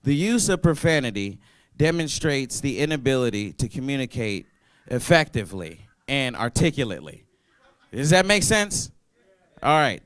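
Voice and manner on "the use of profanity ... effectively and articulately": reading from notes. Acted. Comic reading his written note to the crowd, formally. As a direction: read it like a prepared statement. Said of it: "'cause I wrote this down".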